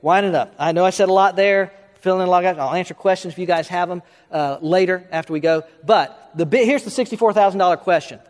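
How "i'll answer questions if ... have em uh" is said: usual speaking voice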